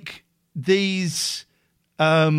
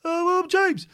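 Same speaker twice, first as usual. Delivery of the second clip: louder, then pitched up high for James; mock contestant voices; 3 semitones higher, 5 dB louder than usual. Host imitating contestants saying their names, hammed up. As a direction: ham up each guy introducing himself. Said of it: "That's how they talk".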